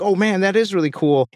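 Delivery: high, admiring exclamation; impressed. Retold or imitated. People at the table admire the gallery, high and impressed. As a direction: gush about how cool it is. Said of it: "They're like".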